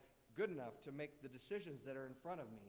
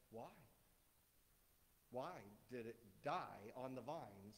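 ordinary talk

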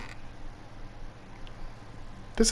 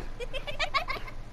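high panicked laugh; 13 dB quieter than usual. Real, not acted. Panicked reaction to the figure.

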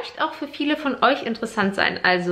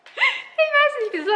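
laughing. Real, not acted. Laughs during the greeting.

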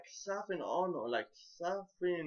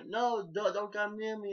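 mock alarmed plea; 5 semitones higher than usual. Retold woman fleeing her pursuer.

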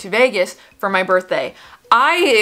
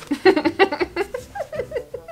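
high, soft embarrassed giggle; rueful laugh; 12 semitones higher, 3 dB quieter than usual. Real, not acted. Mocking her own crush on Joe, a soft laugh. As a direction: giggle at your own foolishness.

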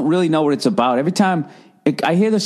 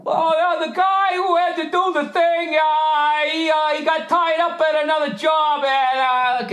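much higher sing-song mock voice; mocking excuse voice; 12 semitones higher, slower than usual. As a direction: sing the lame excuse high and silly.

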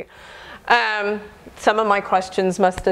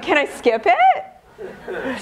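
slow, laughing half-joking plea; 5 semitones higher, much slower than usual. Retold or imitated. Patient asking the doctor to skip treatment, laughing. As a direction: drawl it out through a laugh.

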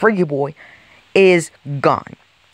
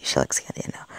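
whisper; 10 dB quieter, much faster than usual. Real, not acted. Plotting aside to himself.

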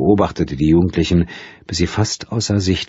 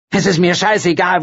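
high, scornful snap; contemptuous; 12 semitones higher than usual. A narrator's reading. Woman scorning the missing writer to the detective. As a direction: spit it out scornfully.

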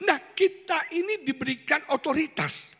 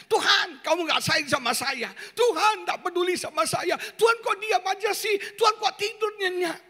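complaining shout; 8 semitones higher than usual. Acted. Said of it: "Petrus komplain". (keluhan keras bernada tinggi)

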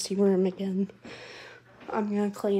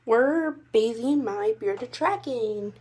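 ordinary talk

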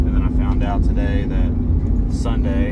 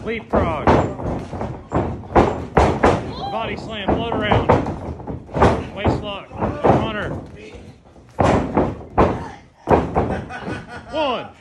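raised voice calling each move; coach calling drills; 9 semitones higher, slower than usual. Real, not acted. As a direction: call the moves out to the ring.

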